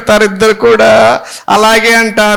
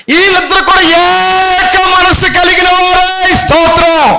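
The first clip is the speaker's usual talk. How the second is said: preaching shout; 8 semitones higher, slower than usual. Real, not acted. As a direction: shout it out to the church.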